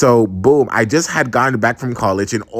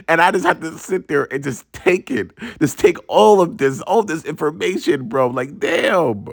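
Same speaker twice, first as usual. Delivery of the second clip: ordinary talk, nothing marked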